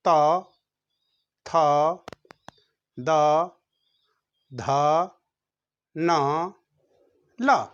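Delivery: त, थ, द, ध, न, ल with the tongue against the teeth; tongue tip on the teeth for त थ द ध; one-by-one dental drill; dental letters demo. Shown as a demonstration. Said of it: "जीभ कहाँ जा रहा है?".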